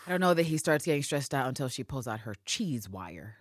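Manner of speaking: ordinary delivery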